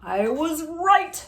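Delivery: high, loud, slow gloating doctor; triumphant doctor. A narrator's reading. Doctor after being knocked flat, slow and triumphant. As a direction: savor being proven right. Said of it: "said the doctor".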